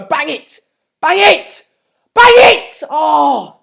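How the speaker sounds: carried-away football fan screaming; excited shout. Real, not acted. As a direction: scream at the players to shoot, ending on a loud "oh". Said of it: "I shouldn't have shouted that".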